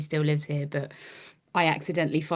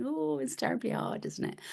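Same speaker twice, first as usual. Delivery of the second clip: soft, higher, condescending sympathy; patronizing; 8 semitones higher, 9 dB quieter than usual. Retold or imitated. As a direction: pat him on the head with your voice. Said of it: "said something really patronizing".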